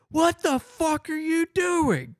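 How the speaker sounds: high loud slow yell; mock furious mom. Mom finds her kid out of the car, loud slow angry yell. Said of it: "She's like".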